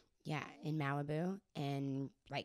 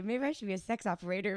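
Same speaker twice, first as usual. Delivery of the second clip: joking quote; 3 semitones higher, 6 dB louder, faster than usual. Acted. Hammed-up joke about her voice.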